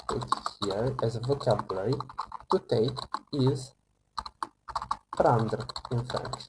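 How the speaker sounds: usual speaking voice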